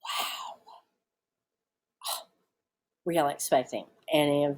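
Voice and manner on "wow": whispered, voiceless wow; barely-there breath of amazement; hushed awe. Real, not acted. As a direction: let it out as a soft, stunned whisper. Stunned reaction after story clip ends.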